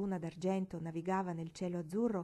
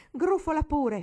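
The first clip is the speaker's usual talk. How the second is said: loud, high scornful jab; indignant thought; 9 semitones higher, 10 dB louder, slower than usual. A narrator's reading. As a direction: spit it at the piglet. Pensiero indignato di Marigold. (sdegno rabbioso e acuto)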